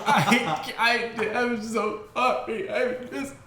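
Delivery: high, drawn-out blubbering apology; hammed-up ugly crying through the words; mock sobbing. Ten-year-old apologizing to the teacher, mock sobbing. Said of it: "I start sobbing".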